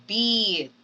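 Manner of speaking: "beat" with a long tense ee; long E demo. Shown as a demonstration. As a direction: stretch the ee in "beat". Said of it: "Say, beat".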